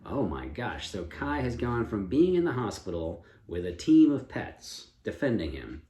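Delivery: ordinary delivery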